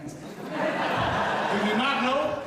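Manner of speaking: very slow, each word drawn out; slowed right down. Shown as a demonstration. Preacher slowing down for his interpreter, very slow. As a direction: stretch the question out slowly, half laughing. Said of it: "You have to slow down".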